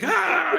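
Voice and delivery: high "ah" of diving in; imitated effort yell. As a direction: cry out as if flinging yourself over. Imitates diving over the bush.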